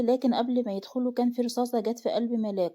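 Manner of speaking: usual speaking voice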